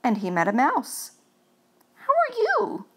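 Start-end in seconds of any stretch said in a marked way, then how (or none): 1.9-2.8 very high, light ghostly voice; friendly ghost voice. Acted. Friendly ghost Gus greeting a cold mouse, high and bright. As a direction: play Gus as a sweet high-pitched ghost.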